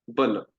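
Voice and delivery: बल said short and light; quick बल with little breath. Shown as a demonstration. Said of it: "बोलिए".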